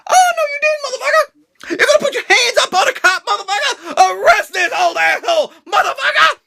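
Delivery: hammed-up high shrieking outrage; shrill mock-indignant cop; sassy cop. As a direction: squawk it in mock fury. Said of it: "the cop's like".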